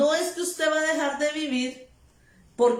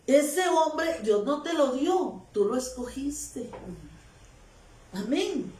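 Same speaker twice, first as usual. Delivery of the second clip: ordinary talk, nothing marked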